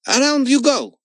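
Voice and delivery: high, loud command to the tigers; boy shouting orders at circling tigers; shouted. Acted. Boy commands tigers in the ring, high shout. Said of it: "shouted the boy".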